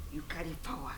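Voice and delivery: very soft, low whisper; whispered admiration. Acted. Woman praising her lover from last night, whispered. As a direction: breathe the praise out under your voice.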